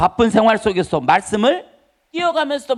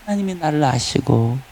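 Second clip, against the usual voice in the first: low, unhurried talk with God; conversational prayer; 7 semitones lower than usual. Acted. Preacher modelling prayer as communion with God, low conversational prayer. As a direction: confide in God, pitch dropped low. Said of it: "이게 기도라는 거예요".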